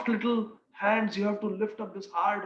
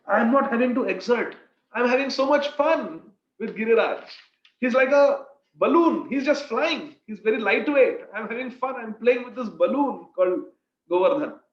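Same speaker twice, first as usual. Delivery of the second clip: put-on playful child Krishna, higher and louder; 3 semitones higher, 6 dB louder than usual. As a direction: reassure Maiya, carefree and playful. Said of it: "He says".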